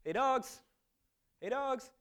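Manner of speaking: hollering hey dogs at unseen dogs; calling out. Retold or imitated. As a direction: yell out from the car to get the dogs' attention. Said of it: "kinda yelled out".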